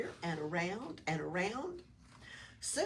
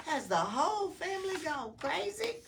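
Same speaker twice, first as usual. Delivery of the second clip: high, louder lizard voice; Oscar incredulous; 9 semitones higher, 6 dB louder than usual. A narrator's reading. Lizard wonders at the decorated house, high and incredulous. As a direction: ask it up high in disbelief.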